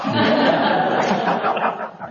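unvoiced breathy laugh; real breathy chuckle. Real, not acted. Laughs after the story.

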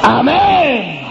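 preacher exulting over Joseph's rise; triumphant shout. Acted. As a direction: proclaim it in triumph.

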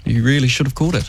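exaggerated sarcastic repeat. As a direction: lean into the sarcasm.

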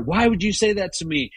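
high, fast, accusing outburst; hammed-up hurt husband lashing out; mock accusing rant, pitched well up and quick; imagined angry retort. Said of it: "got into a whole thing with her".

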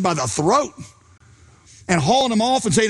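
ordinary talk, nothing marked

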